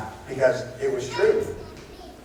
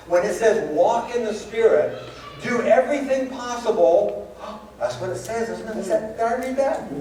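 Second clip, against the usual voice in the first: preaching at full force; 7 semitones higher than usual. Acted. Preacher urging the congregation to keep unity. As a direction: drive the scripture home from the pulpit.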